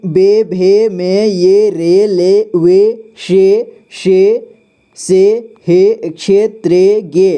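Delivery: teacher reads each syllable aloud for the class; drawn-out classroom drill. Shown as a demonstration. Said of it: "इसका उच्चारण इस तरह से किया जाता है".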